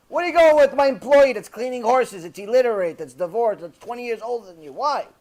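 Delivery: retold angry outburst, starting high and loud; really upset. Father objects to daughter marrying his employee, upset outburst. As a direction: play an outraged father scolding. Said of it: "got really upset".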